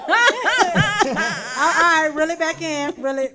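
loud laughing as she tries to get back on track; cracking up, then settling. Real, not acted. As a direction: laughs loudly while trying to resume her compliment. Laughing, pulling self together.

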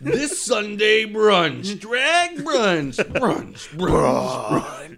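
slow, loud, high hype announcer; drag brunch ad. Acted. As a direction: bellow it like a monster truck promo.

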